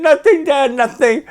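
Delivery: high, weepy denial; tearful daughter. Retold or imitated. Daughter on the phone, crying, high-pitched and tearful. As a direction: brush it off through tears. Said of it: "called me crying".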